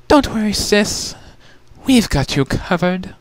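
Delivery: high, easy reassurance; reassuring sister. Acted.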